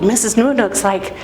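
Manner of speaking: ordinary delivery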